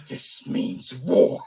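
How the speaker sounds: slow, low hissing threat; raspy whisper. Retold or imitated. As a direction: whisper the vow slowly. Chucky voiced vowing revenge.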